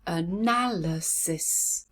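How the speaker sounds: "Analysis" very slowly as a-NAL-y-sis; syllable by syllable. Shown as a demonstration. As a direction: count out four beats in "Analysis". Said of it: "How many syllables?".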